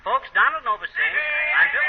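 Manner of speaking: high, loud radio announcer build-up; announcer intro. Acted. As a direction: announce it to the studio crowd. Announcing the song on air.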